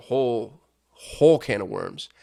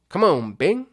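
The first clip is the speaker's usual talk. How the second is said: impatient coaxing; 7 semitones higher, 8 dB louder, slower than usual. Real, not acted. Urging an uncooperative search engine.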